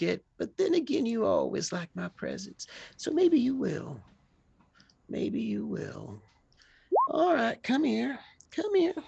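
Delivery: put-on high, teasing old woman's voice; old woman coaxing. Hattie teases Moses about his present, high-pitched. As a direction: coax the young man over with a gift.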